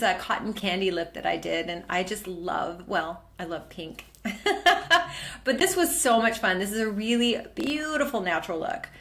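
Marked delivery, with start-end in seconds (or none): 4.2-5.4 high-pitched laugh; amused laugh at your own remark. Real, not acted. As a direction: break into a high giggle. Laughs after admitting loving pink.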